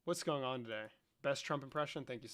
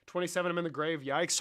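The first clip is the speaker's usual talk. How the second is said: usual speaking voice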